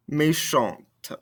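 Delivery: "Méchante" falling onto a released T; sounded ending consonants. Shown as a demonstration. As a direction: let the T of "Méchante" be heard. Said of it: "makes us pronounce those ending consonants".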